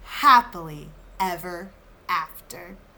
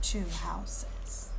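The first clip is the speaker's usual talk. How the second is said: whispered; 5 semitones lower, 11 dB quieter than usual. Acted. Teacher whispers question.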